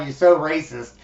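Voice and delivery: ordinary talk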